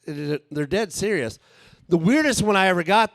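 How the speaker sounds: normal talking voice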